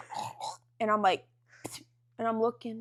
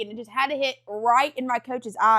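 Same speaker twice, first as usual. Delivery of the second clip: ordinary delivery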